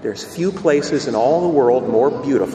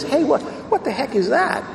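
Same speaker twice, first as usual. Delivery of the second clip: high-pitched baffled question; mock bewilderment, pitched well up; puzzled surprise; 11 semitones higher than usual. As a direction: ask it high, as if baffled by the sight. Priest questions a local, acted.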